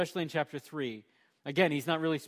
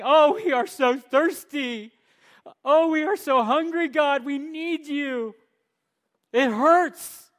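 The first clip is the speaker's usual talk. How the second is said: pleading to God; 10 semitones higher, 10 dB louder, slower than usual. Retold or imitated. Israel coming honestly to God.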